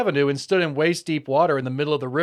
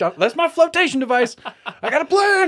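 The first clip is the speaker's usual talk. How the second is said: high-pitched, gleeful boast; mock excitement; 10 semitones higher, 4 dB louder than usual. Host voices the fleeing suspect with his cooler, mock-excited. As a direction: shout up high like a fool with a scheme. Imagined fugitive explaining his escape.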